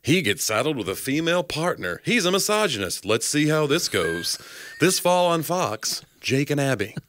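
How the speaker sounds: hammed-up, drawn-out network promo read; buddy cop promo. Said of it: "it's like a buddy cop".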